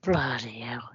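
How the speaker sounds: breathless whisper of dread; whispered exasperation. Real, not acted. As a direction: breathe it out, worn out. Exhausted reaction, whispered.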